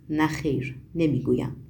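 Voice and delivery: low, curt refusal; firm, dry and low-pitched. A narrator's reading. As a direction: refuse drily, voice dropped low. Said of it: "با خشکی و قاطعیت گفت".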